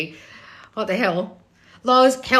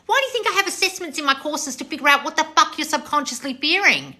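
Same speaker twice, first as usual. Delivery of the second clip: usual speaking voice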